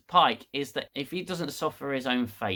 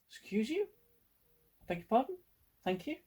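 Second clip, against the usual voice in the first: indignant surprise; 7 semitones higher, 8 dB quieter, faster than usual. Real, not acted. Reacting to an interruption.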